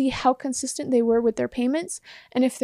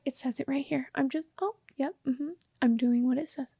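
soft, unbothered mock calm; quiet, breezy, a hint of teasing; perfectly calm; 9 dB quieter than usual. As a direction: stay sweetly calm against his yelling. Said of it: "I was perfectly calm".

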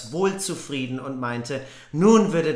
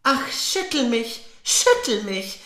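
higher voice of the apple tree; apple tree calling; 6 semitones higher, 3 dB louder than usual. Acted. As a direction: plead to be shaken, pitched up. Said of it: "der rief".

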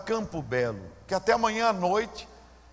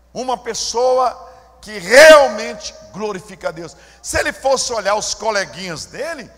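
preaching; 8 dB louder than usual. Acted. Preaching climax, slowed.

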